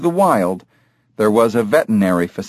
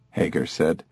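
ordinary delivery

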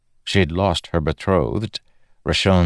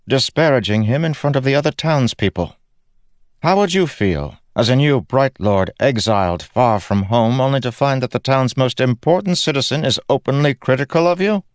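higher, indignant noblewoman's reproach; indignant Laurel; 8 semitones higher than usual. Acted. Laurel defends her husband to Kaladin, indignant. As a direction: turn the question back on him, rising at the end.

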